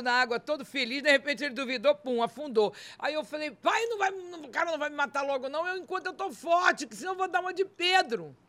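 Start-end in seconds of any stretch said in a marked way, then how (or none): 3.5-8.4 high-pitched, acted-out pleading; urgent plea. Bargaining with God not to die, high urgent plea. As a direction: plead upward in a raised pitch.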